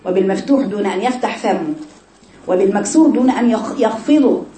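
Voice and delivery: normal talking voice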